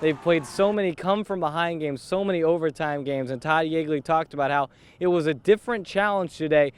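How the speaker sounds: ordinary talk, nothing marked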